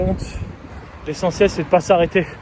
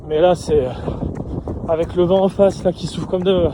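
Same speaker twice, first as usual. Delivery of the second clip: breathless words between strides; out of breath; 3 semitones higher than usual. Real, not acted. Mid-marathon, headwind, exhausted.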